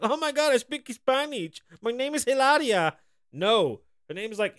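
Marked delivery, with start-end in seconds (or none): 0.0-3.0 very high, hammed-up Spanish accent; Hilaria Baldwin, fake Spanish accent. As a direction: gush in a mock Spanish accent. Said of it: "she's like".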